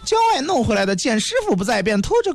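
high, pleading servant voice; shrill palace servant. Acted. Young eunuch begs the emperor.

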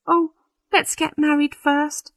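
high-pitched anxious bride. A narrator's reading. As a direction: plead with mild nervous urgency. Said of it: "said the bride anxiously".

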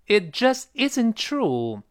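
"just" high and slow for emphasis; emphatic just. Shown as a demonstration. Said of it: "用于加强语气".

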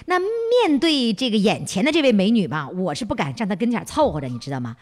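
usual speaking voice

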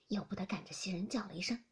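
ordinary delivery, nothing marked

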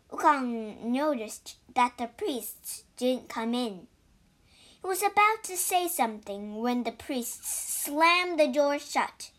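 plain reading voice

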